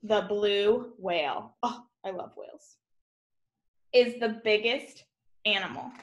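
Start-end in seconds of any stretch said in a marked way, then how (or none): 1.6-2.8 quick breathy whisper to herself; whispered aside. Real, not acted. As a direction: slip in a fast whispered aside, much softer.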